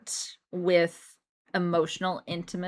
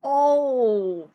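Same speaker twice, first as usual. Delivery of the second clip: surprised oh; 5 semitones higher, 4 dB louder than usual. Real, not acted. Reacting to the partner's line.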